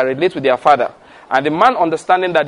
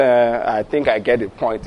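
lightly voiced son, pitched lower and softer; low, slightly sheepish quote of a son; oldest son; 7 semitones lower, 4 dB quieter than usual. Oldest son admits to dad he gets the point, lightly imitated. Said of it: "My oldest son will say".

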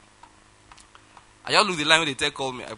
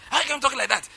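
indignant caller; 10 semitones higher than usual. Retold or imitated. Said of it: "being somebody who didn't get my point".

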